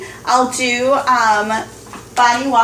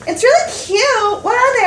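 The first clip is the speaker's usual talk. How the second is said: innocent curious friend; 11 semitones higher than usual. Retold or imitated. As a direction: play the clueless friend, pitched way up. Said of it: "she's like".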